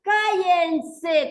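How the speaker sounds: loud, high classroom "shut up". Shown as a demonstration. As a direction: demonstrate a teacher yelling for silence. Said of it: "Estoy levantando la voz".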